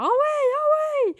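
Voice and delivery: high, bright excited exclamation. Retold or imitated. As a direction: squeal it out excitedly. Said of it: "j'étais genre".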